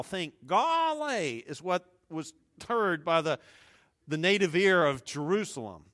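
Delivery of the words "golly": hillbilly exclamation, high and drawn out; slow, high hillbilly drawl; Gomer Pyle. Acted. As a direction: stretch it out like a country bumpkin, high-pitched. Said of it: "Think Gomer Pyle".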